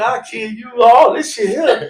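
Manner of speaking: half-laughing threat, high and loud; laughing fake threat; mock threat shout. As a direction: yell it while cracking up.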